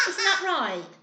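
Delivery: ordinary delivery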